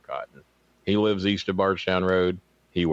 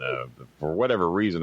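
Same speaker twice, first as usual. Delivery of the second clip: ordinary talk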